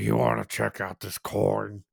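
put-on old-man voice; imitating old regular. Old man offering old coins to teen, imitated voice. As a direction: pitch it like an eager old peddler. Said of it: "he'd be like".

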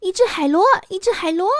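high, loud amazed cry; amazed shouting. Acted. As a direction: cry out in wonder. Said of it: "孩子们惊奇地喊".

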